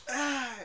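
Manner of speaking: short, high, loud startled shout; mock yell at a sleeping homeless guy; mock startle yell. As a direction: jolt someone awake with a yell. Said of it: "someone just like".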